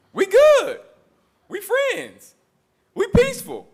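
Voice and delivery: high, syrupy mock friendliness; mock sweet talk. Preacher mocks the enemy's fake peace, high and syrupy.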